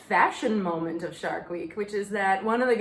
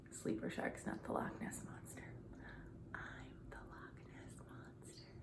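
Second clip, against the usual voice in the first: much softer, unvoiced whisper; whispered confession; 22 dB quieter than usual. Confessing to viewers she is the monster, whispering. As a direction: lean in and confide the secret.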